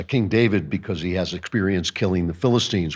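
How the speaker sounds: ordinary talk, nothing marked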